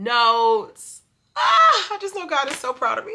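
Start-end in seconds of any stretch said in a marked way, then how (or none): 1.4-3.1 high, loud squeal easing into fast gushing; real high-pitched burst of joy; delighted outburst. Real, not acted. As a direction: squeal with delight, then gush it out. Delighted outburst over her notes.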